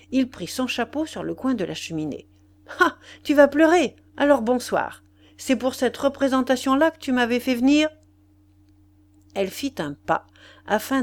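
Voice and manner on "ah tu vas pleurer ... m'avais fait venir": high, loud, exasperated dismissal; scornful outburst. A narrator's reading. Lover walking out as his mistress starts crying, scornful and angry. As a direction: throw her tears back at her.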